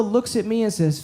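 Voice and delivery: normal talking voice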